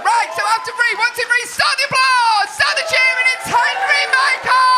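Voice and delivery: high, loud announcer build to the name; hype announcer shout. Real, not acted. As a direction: hype the room like a ring announcer. Host hyping crowd.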